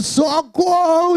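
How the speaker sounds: long, high drawn-out "help" cry; high-pitched comic cry for rescue, not at full volume; retold scream for help. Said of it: "levantei gritando".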